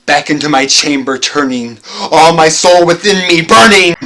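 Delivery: loud and burning, swelling up; a loud outburst of inner fire; agitated loud. A narrator's reading. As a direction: let the agitation flare out loud.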